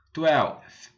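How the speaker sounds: twelfth repeated clearly; twelfth, teacher repeat; repeat after me. Shown as a demonstration. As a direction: echo twelfth for students. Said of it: "O décimo segundo".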